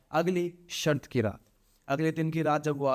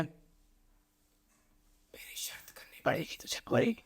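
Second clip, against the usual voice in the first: slow breathless whisper right in his ear; eerie drawn-out whisper; spirit's whisper; 6 dB quieter, much slower than usual. A narrator's reading. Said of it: "इसके कान में आके बोलती है".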